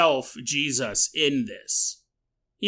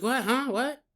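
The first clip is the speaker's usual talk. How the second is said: higher, softer bewildered stammer; mock startled; 7 semitones higher, 5 dB quieter than usual. Man dodging a thrown lamp, mock startled. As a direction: play at being caught off guard.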